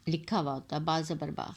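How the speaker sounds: short با said quickly with no stretch. Shown as a demonstration. Teacher showing a short ba to students. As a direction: read با as a short clipped syllable. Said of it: "تو ہم اس کو پڑھتے با".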